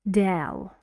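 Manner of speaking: del said alone, stressed; stressed syllable del. Shown as a demonstration. Teacher points out stressed syllable del to students. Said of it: "This here is the air sound".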